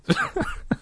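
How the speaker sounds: short amused giggle; high laugh. Real, not acted. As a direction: laugh at yourself. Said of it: "reader laughs".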